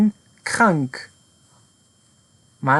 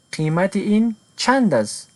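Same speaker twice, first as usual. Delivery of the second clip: ordinary delivery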